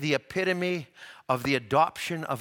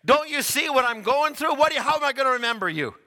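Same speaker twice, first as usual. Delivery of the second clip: high, fast and louder, railing at him; exasperated thief's retort, rushed and high; mocking thief; 9 semitones higher, 3 dB louder, faster than usual. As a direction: sneer it out like the thief on the cross. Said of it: "He says".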